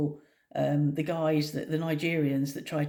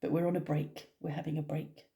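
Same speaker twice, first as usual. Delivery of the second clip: ordinary talk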